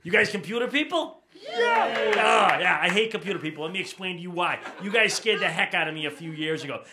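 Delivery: usual speaking voice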